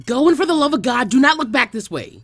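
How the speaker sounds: urgent. Acted. Tintin urgently pushing Naruto.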